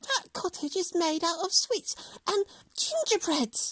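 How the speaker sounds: put-on high, delighted child voice; amazed at the candy house; excited.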